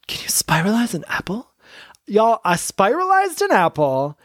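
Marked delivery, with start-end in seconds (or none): 0.1-1.5 hushed excited whisper. Retold or imitated. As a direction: breathe it like a secret discovery. Whispered discovery of an apple.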